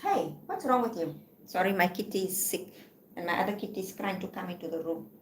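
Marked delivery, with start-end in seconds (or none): none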